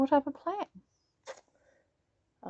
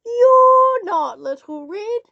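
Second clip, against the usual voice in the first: loud, slow shout; loud shocked shout; 9 semitones higher, 8 dB louder, slower than usual. A narrator's reading. Granny shouts at wolf.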